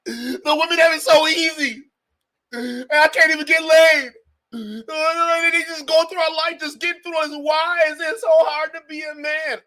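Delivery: high, loud hammed-up whine; mock wailing. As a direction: wail in exaggerated self-pity, mockingly. Mimics red pill men wailing.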